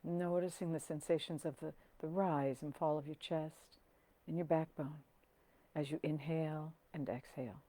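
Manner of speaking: soft, unhurried meditation voice with long pauses; hushed, settling calm; guided meditation. Acted. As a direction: guide them gently down into the body.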